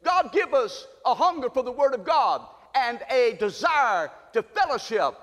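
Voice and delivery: fervent pastoral prayer. Acted. Pastor praying over the church, fervent. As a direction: pray it for the whole church.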